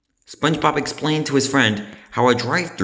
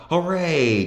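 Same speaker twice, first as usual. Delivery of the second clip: cheer; 6 semitones higher than usual. A narrator's reading. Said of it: "cheered the bikers".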